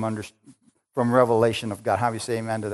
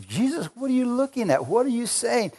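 puzzled disciples; 10 semitones higher than usual. Pretend.

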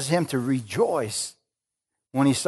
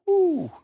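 joyful whew. Real, not acted. Preacher overcome with joy.

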